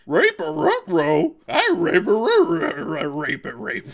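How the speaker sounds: loud, slow, botched Scooby-Doo impression; a failed cartoon dog voice, ruh-roh. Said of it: "I can't do Scooby-Doo".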